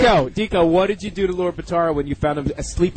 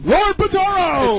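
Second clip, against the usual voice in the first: announcer voice; 11 semitones higher, slower than usual. Acted. Introducing the special guest.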